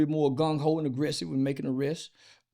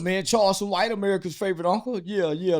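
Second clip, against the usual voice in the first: usual speaking voice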